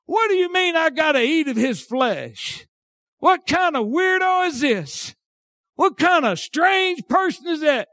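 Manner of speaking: high-pitched, incredulous mock objection; incredulous scoffer. Acting out a scoffer's questions.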